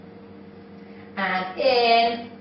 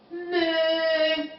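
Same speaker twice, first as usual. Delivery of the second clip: softer, higher imitated moo; cow moo; 4 semitones higher, 5 dB quieter than usual. Shown as a demonstration. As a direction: moo softly like a cow, held out.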